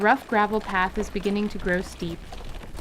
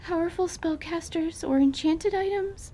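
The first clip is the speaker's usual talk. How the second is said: frantic; 8 semitones higher than usual. Acted. Anise still panicked.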